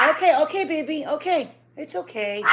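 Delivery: high, loud reassurance to a dog; soothing pet talk. Real, not acted. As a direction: reassure an agitated dog over its barking. Soothing her barking dog.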